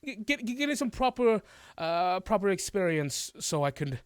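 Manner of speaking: smooth over it like a guilty showman; author excusing himself. Acted.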